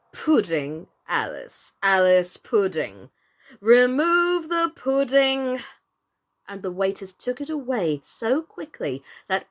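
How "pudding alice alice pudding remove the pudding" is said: sulky, slow growled introduction. A narrator's reading. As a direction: growl it out sulkily. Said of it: "looked sulky and growled".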